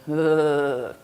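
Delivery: long drawn-out "ugh" noise; mock guttural groan; imitated animal groan. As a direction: groan it out like a mimicked animal call. Imitates daughter's noise for lovers communicating.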